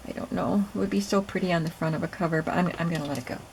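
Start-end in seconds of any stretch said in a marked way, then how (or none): none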